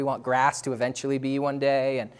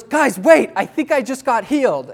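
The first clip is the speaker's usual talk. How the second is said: excited interruption; 8 semitones higher, 7 dB louder than usual. Retold or imitated. Stuart interrupts the prayer.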